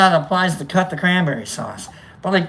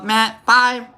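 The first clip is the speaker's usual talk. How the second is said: ordinary delivery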